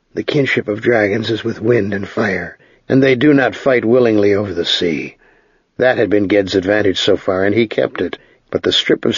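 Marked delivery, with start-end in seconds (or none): none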